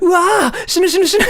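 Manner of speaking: high, loud wailing through laughter; embarrassed wail. Acted. Kid mortified at calling teacher Mom, laughing wail. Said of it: "what a lot of kids do in Japan".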